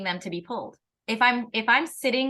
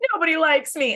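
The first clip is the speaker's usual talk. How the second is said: loud, higher hammed-up self-pity; mock whining; 6 semitones higher, 6 dB louder than usual. Sulker left at the edge of the sandbox, mock whining. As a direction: whine it like a sulking child.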